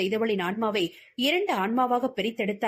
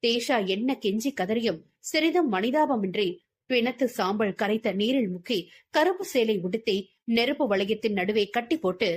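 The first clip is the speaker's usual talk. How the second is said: ordinary delivery, nothing marked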